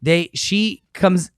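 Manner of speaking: ordinary delivery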